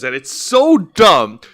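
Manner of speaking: ordinary talk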